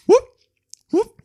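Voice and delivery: very high rising whoop; imitated dog noise. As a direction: mimic a dog's attention-seeking whoop. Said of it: "the noise that the dog makes".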